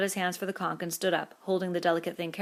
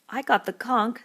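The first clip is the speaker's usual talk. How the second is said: hurt; 6 semitones higher, 6 dB louder than usual. A narrator's reading. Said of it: "said Piggy in a hurt voice".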